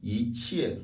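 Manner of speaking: "yi tian" with rising "yi", then a fall; second-tone yi. Shown as a demonstration. Teacher shows fourth-tone case, rising "yi" in "yi tian". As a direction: lift "yi", drop the next syllable in "yi tian". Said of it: "le i devient automatiquement deuxième ton".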